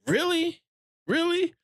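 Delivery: very high-pitched incredulous question; mock disbelief. Viewer unimpressed by Supergirl trailer, high incredulous disbelief. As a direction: squeak it upward, unconvinced.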